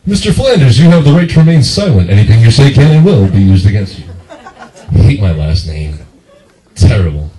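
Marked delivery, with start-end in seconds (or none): 0.1-4.0 loud officer reading out rights; forceful police warning, imitated; arresting officer. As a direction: start high and loud, recite it like an arrest. Officer arrests him in bit.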